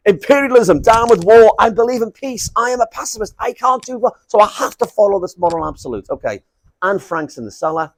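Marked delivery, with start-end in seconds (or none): 0.1-6.1 high-pitched, declamatory parody; mock dogmatic activist. Pacifist who must follow the moral absolute, high mock declaiming. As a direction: recite it like a sloganeering zealot.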